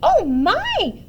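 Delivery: put-on high, loud old-lady alarm; little old lady's shocked outcry; startled cry. A narrator's reading. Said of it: "cried the tiny old lady".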